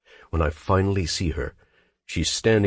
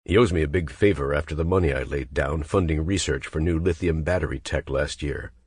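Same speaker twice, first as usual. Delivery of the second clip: ordinary delivery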